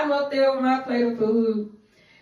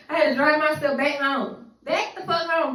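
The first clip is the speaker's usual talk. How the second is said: ordinary delivery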